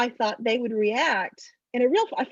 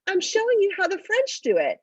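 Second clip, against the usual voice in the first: put-on much higher, earnest little kid voice; proud six-year-old; 10 semitones higher, 4 dB louder than usual. Amber showing her sister how the French kiss, proud. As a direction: explain it like it's obvious. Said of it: "And she goes".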